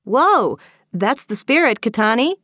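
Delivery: high, loud cheer for a friend; spirited call of encouragement; encouraging shout. Acted. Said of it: "Avery shouted encouragingly".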